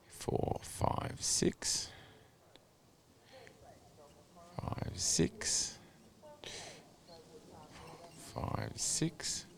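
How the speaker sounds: counting the changes in a bare whisper; murmured tally while watching the horse. Real, not acted. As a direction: count them off under your breath, hushed. Counting the horse's tempi changes under breath.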